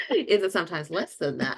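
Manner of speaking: asked through laughter; laughing. Retold or imitated. Teasing the airport staff, laughing. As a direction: giggle while asking, teasing.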